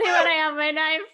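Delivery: words squeezed out through real laughter; laughing. Real, not acted. Joking with the guest about her knife, laughing. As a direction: crack up while finishing the joke.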